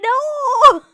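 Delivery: loud, high wailed admission; hammed-up anguished denial; mock wail. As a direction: cry it out in comic despair. Wailed admission about the bad haircut.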